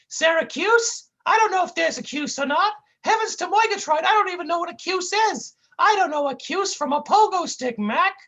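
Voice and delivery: high goofy cartoon whine. Sidekick whining at his mean partner, high goofy voice. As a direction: plead like a hurt buffoon.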